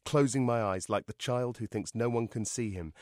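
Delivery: usual speaking voice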